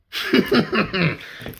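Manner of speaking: sudden high-pitched laugh; a real laugh bursting out; high burst of laughter. Real, not acted. Laughs at own thought.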